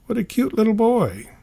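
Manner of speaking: put-on sweet high-pitched adult cooing at a child; Sherry Lewis voice. TV hostess cooing over a little boy, pitched high. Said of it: "Sherry patted me ... and declared".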